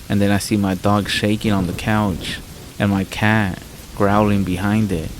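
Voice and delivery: plain reading voice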